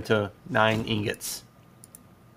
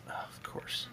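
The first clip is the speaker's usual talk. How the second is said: whisper; 13 dB quieter than usual. Real, not acted. Sudden whispered aside.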